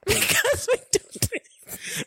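breathy, high laughing words. Real, not acted. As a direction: laugh while saying it.

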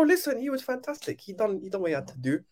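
usual speaking voice

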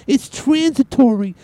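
high, slow mock Brooklyn accent on it's transitory; Janet Yellen impression. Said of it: "She's the one who talks like this".

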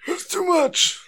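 put-on very high, strained old-timer voice; comically feeble high-pitched protest; overwhelmed old caster. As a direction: wheeze it like a spent geriatric wizard. Playing exhausted old caster.

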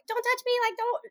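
high-pitched mock protest; very dramatic. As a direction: imitate a drama queen fending someone off. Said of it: "like, very dramatic".

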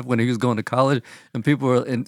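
ordinary talk, nothing marked

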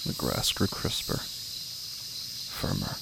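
slow, hushed, half-whispered dread; eerie horror narration. A narrator's reading. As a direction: draw the listener into the dark pond, slowly. Eerie horror story narration opening.

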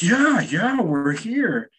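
high-pitched imitation of an eager friend; eager coaxing. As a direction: coax the spirit in a high, friendly voice.

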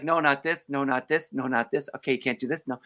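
low, soft, repetitive muttering; quiet, low checklist voice; assistant's quiet refusals. Retold or imitated. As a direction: rattle off refusals under your breath. Assistant crossing out his material.

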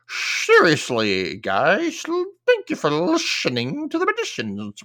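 cartoon beaver lisp, slow start swooping high; hammed-up lisp. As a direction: slush every s into "sh" like a cartoon beaver. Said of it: "Why am I talking like this?".

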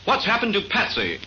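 ordinary talk, nothing marked

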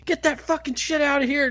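high-pitched imitated scolding. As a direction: chase him off, acting the irate homeowner. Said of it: "Just fucking berating him".

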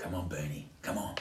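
soft impatient muttering. Real, not acted. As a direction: mutter it under your breath. Muttering while phone rings.

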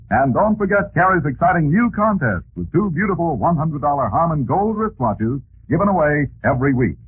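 put-on bright, punchy old radio announcer. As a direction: sell it like a 1940s announcer.

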